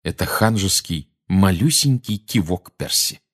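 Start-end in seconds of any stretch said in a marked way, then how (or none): none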